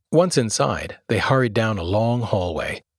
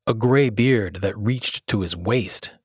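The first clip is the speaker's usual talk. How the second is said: plain reading voice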